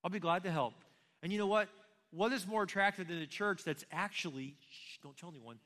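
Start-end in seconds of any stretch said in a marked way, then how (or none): 4.7-5.6 a soft conspiratorial aside to the congregation; hushed aside. Pretend. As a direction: drop to a near-hush for a mock secret. Joking secret aside mid-sermon.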